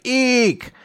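high startled eek; cartoonish startled squeak; mock squeal. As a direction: squeal at the spill. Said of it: "she's like".

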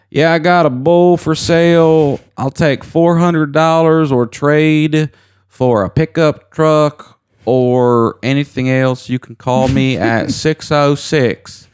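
Kentucky caller. Retold or imitated. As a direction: drawl out the bull sale like a rural caller. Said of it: "the guy came on there. He's like".

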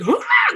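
very high, loud whooping shriek; imitated scream. Retelling mom's reaction to the baby flying out, imitated scream. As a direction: shriek it out like a startled mom. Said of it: "my mom screamed at the top".

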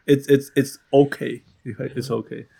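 ordinary delivery

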